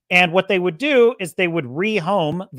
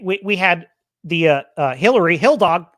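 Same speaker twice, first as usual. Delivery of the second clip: ordinary delivery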